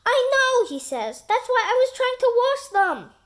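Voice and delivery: high, loud sheepish protest; high squeaky Terry. Acted. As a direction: squeak out a guilty excuse. Terry defends his dirty underpants.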